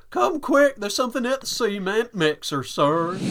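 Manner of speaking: high, loud Southern yokel voice jumping up at the start; deep south accent. Retold or imitated. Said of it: "there's just something about the accent that gets me".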